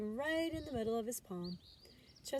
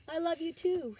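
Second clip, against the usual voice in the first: high, loud, cheerful shout; happy child; 9 semitones higher, 6 dB louder than usual. A narrator's reading. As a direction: call it out joyfully.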